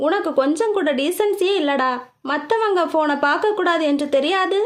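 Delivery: ordinary delivery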